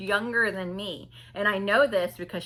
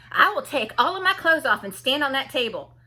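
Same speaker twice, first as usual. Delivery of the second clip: put-on loud, higher, emphatic girlish threat; mock threat; 5 semitones higher, 7 dB louder than usual. Young girls threatening in the common room, mock defiant threat. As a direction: threaten it with every word punched. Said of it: "but they threatened to".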